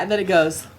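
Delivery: ordinary delivery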